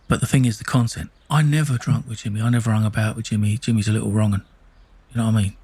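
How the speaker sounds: ordinary talk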